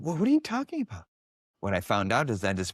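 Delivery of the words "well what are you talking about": incredulous. Retold or imitated. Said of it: "I said".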